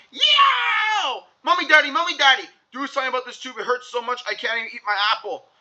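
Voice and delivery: high yowl calling both parents; child yelling in pain. Acted. Andrew to both parents about his tooth, high pained yell. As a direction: yelp for mommy and daddy. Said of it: "and yelled".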